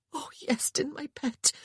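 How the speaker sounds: soft, high whispered comfort; tender sister's whisper. A narrator's reading. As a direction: hush over his wounds. Sister tenderly over injured boy.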